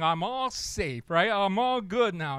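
slow, pleased little mouse voice; drawn-out relief of a foolish mouse; smug mouse. Acted. Mouse sure the snake is gone, slow and smug.

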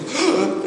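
sharp acted gasp of fright; sudden mock gasp; imitated startled gasp. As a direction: gasp as if something jumped out. Imitating haunted house scare.

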